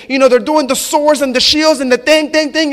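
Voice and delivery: usual speaking voice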